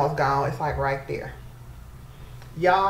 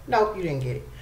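ordinary delivery

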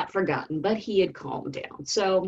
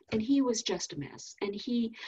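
normal talking voice

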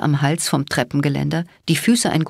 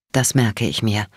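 lower, holding anger back; barely controlled; 6 semitones lower than usual. A narrator's reading. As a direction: clamp down on the rage. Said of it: "mit mühsamer Beherrschung".

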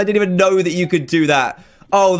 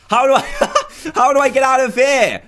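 high-pitched question broken by laughter; amused, squeaky-high exclamation; laughing bewilderment; 9 semitones higher than usual. Real, not acted. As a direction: ask it helplessly through giggles. Stuck inside Olaf's head, laughing.

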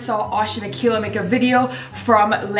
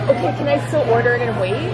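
ordinary talk

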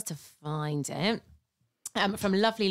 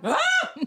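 loud, high mock yell of release; retold yell; 6 dB louder than usual. Telling how she lobbed the gingerbread man at the wall, a loud high yell. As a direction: let out a high cry as you throw it. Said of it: "And I went".

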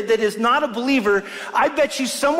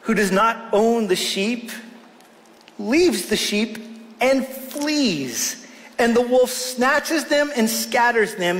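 scripture reading; much slower than usual. Acted. Reads scripture aloud slowly.

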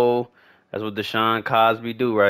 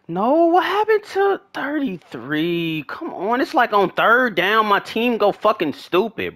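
high-pitched, incredulous complaint; exasperated rant; 8 semitones higher than usual. Real, not acted. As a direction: whine in disbelief at your useless team. Complaining about team on third down.

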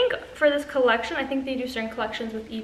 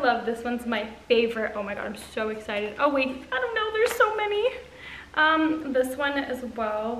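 normal talking voice

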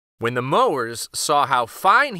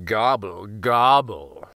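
greedy fox; 6 semitones lower, slower than usual. Acted. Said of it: "went the fox".

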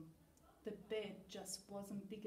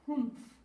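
loud grumpy humph; a gruff walrus snort; 5 semitones higher, 11 dB louder than usual. Acted. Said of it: "said Walrus".

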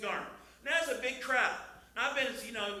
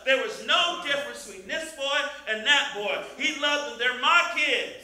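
loud, raised, exultant preacher; full-force preaching; 7 semitones higher, 10 dB louder than usual. Real, not acted. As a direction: build to shouted praise.

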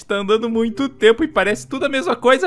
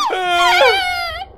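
a higher, fading cry at the end of the fall; whimpering cry; 3 dB louder than usual. Acted. Steve, still falling, cries out as the scream fades. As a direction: let the scream sink into a whimper.

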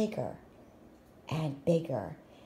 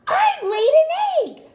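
a high, loud, thrilled exclamation; excited cry; 9 dB louder than usual. Acted. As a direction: shout it out in delight at the discovery. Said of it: "cried Pig".

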